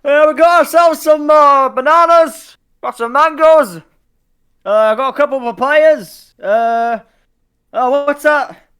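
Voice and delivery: very high, loud holler; strained high-pitched sailor's shout; deckhand shouting back. Acted. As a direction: yell out the cargo list from afar. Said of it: "And Bob goes".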